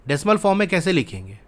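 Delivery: normal talking voice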